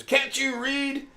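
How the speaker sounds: high, slow, exaggerated clerk scolding; snippy store clerk. Store employee scolding the shoppers at the alarm, high mocking voice. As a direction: mimic a stroppy employee, drawn out and high.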